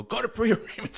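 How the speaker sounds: high-pitched imitation of a barking officer; barked order. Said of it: "So they bark at me".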